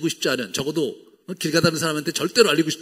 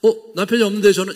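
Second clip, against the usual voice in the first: stern reply; 6 semitones higher, 8 dB louder, slower than usual. Retold or imitated. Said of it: "이렇게 엄격하게 답을 했는데".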